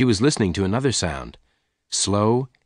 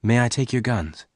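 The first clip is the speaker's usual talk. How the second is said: ordinary delivery, nothing marked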